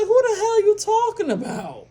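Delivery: high, startled "who the hell" question; incredulous outburst. Retold or imitated. To her auntie, stunned at the name, high disbelieving question. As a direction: throw the question back, baffled. Retelling own reaction to auntie.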